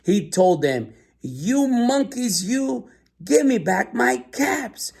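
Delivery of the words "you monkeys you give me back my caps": high, slow mock-angry demand; angry peddler. A narrator's reading. Peddler angrily demanding the monkeys return his caps. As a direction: draw it out like a cross peddler. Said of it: "This made the peddler angry".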